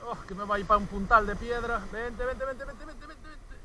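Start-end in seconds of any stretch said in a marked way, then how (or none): 1.9-3.6 rising pitch, dropping to a hush; coaxing, fading to whisper. Real, not acted. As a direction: coax it softly, fading away. Coaxing the fish in. (susurrando para atraer al pez)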